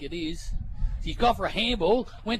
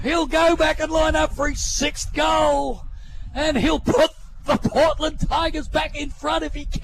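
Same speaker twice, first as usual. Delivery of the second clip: excited call; 5 semitones higher than usual. Real, not acted. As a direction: keep the excitement up.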